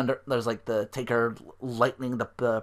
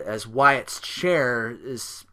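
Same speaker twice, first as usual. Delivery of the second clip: ordinary talk, nothing marked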